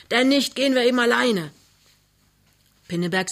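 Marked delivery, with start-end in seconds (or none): 0.1-1.5 loud, high-pitched shout; bellowing. A narrator's reading. Neighbour outside decides to go alone, bellowing. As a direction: shout it off in annoyance. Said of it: "brüllt Krümner draußen".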